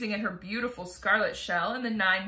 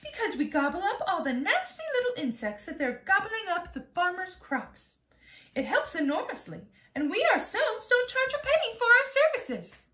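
high, proud little ladybug voice; a prim ladybug boasting sweetly, pitched high; 12 semitones higher, 7 dB louder than usual. Acted. Ladybug explains to James, high and proud.

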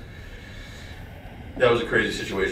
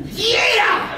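shrieked much higher, a jubilant mock whoop; triumphant yell. Actor reenacting his celebration after nailing the monologue, high excited whoop. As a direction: let out a squeal of pure victory.

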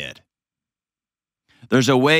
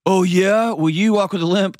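retold retort; 6 semitones higher than usual. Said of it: "like Kelly said".

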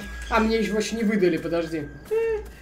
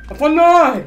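higher, louder repeat of the question; louder insistent; 6 semitones higher, 9 dB louder, slower than usual. Real, not acted. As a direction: demand it louder.

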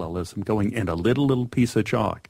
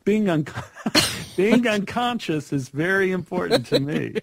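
higher-pitched, breaking into laughter; laughing; 8 semitones higher than usual. Real, not acted. Guest confessing love of being unconscious, laughing. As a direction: say it through a real laugh.

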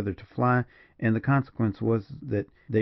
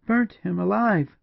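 slow, high cry of disbelief; high, shocked woman's exclaim; horrified exclamation; 10 semitones higher, 4 dB louder, slower than usual. A narrator's reading. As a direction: echo it aghast, pitch leaping up. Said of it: "exclaimed Julia".

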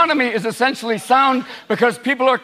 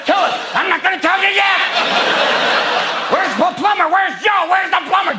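manic McCain; 10 semitones higher than usual. Retold or imitated. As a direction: rant like a man off his meds. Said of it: "wandering around the mall going".

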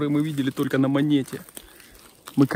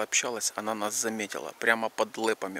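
ordinary delivery

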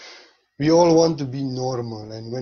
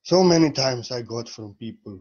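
ordinary talk, nothing marked

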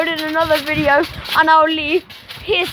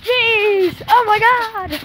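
shocked outburst; 6 semitones higher, much slower than usual. Real, not acted. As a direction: blurt out in horrified surprise. Sees something disgusting.

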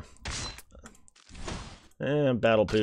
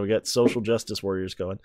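usual speaking voice

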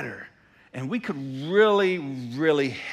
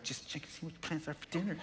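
much softer, mocking mimic through a laugh; mocking repeat; 15 dB quieter, faster than usual. Pastor mocks wife's text to himself, softly mocking. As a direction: parrot the text back with a snicker. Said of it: "I'm like".

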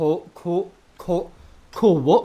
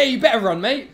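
cocky taunt; 8 semitones higher, 10 dB louder than usual. Pretend. Taunting enemy.

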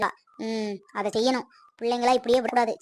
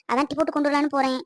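normal talking voice

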